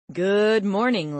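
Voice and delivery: slow, loud, cheerful greeting; jovial. A narrator's reading. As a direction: greet them brightly and drawn out. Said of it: "jovial tone of a real morning person".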